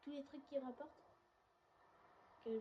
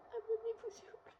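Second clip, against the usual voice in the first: squeaky high voice; 12 semitones higher, 4 dB louder than usual. Pretend. Begs for subscribers in put-on voice.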